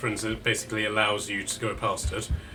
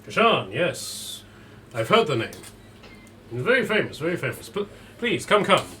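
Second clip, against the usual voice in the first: Vasily von Holt; 3 semitones higher than usual. Acted.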